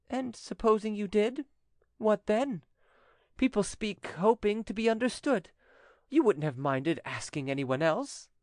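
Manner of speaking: high-pitched, protesting woman; exasperated widow. Acted. Widow urges notary to take her loan, high exasperation. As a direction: press him in a high, impatient voice.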